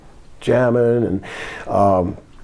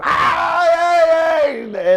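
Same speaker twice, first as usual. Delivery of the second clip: high mock scream; drawn-out high yelp in imitation; imitated scream; much slower than usual. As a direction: imitate a man screaming in panic, pitch shooting up. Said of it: "Coco's screaming now for bloody murder".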